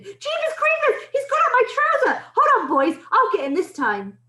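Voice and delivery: high, startled yelling; shouted. A narrator's reading. Cook with a mouse up his trouser, shouting. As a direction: shout it high in alarm to your mates. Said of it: "the cook shouted".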